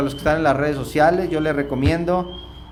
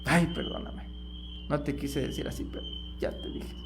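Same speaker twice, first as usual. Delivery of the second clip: much softer, half-hearted sorry; softly to family after getting angry; sheepish apology; 13 dB quieter than usual. Retold or imitated. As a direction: apologize quietly with a shrug. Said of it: "más cuando me enojo".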